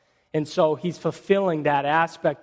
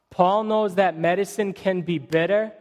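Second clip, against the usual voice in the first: ordinary delivery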